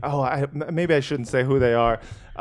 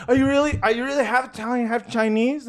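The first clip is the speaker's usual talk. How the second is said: retold comedy duo's question; 8 semitones higher, faster than usual. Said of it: "they're like".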